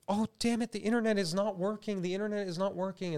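lightly voiced dad griping at the internet; mock frustrated dad. Comedian pitching a scene, lightly voicing the frustrated dad. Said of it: "he's like".

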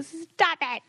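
half-serious exasperated command; mock scolding. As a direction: scold the dog in playful imitation. Acted scolding of a dog.

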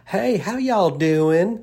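drawn-out Southern "How y'all doing?", "y'all" rising slowly; Southern greeting. Shown as a demonstration. Host greeting listeners with a slow Southern drawl. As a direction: stretch "y'all" like a Tennessee drawl. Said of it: "That's what we would say where I'm from".

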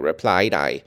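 plain reading voice

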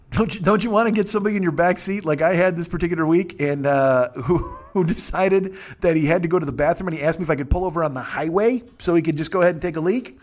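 ordinary talk

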